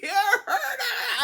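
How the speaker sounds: high, loud laughing reply. Retold or imitated. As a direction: blurt it through a burst of nervous laughter. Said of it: "And I'm laughing".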